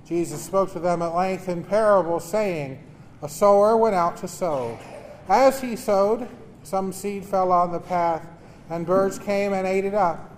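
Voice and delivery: solemn liturgical reading; Gospel reading. Acted. Proclaims the Gospel at Mass.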